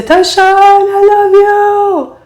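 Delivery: high, slow shouted call across a crowd; a distant fan calling out with love. Retold or imitated. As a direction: call her name from far back in the stands.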